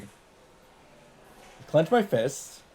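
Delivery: ordinary delivery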